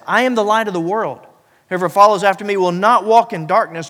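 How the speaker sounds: loud, rising preacher's declaration; preaching at full force. Acted. Pastor proclaiming the light to the church, preaching loud.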